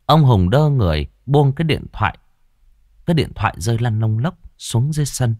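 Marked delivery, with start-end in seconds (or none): none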